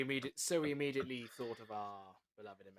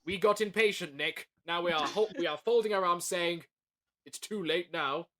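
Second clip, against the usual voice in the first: louder, higher, playfully huffy to Nick; mock defiant; 6 semitones higher, 9 dB louder than usual. Cast member teasing Nick over the new releases, mock huffy and defiant. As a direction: fold your arms and sulk it out, a hammed-up huff.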